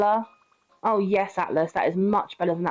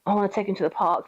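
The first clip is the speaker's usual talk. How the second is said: ordinary talk, nothing marked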